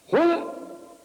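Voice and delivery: high-pitched imitated disbelief; shocked reaction. Woman reacts to the preacher's accusation, imitated shock. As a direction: squeal the question as if stunned.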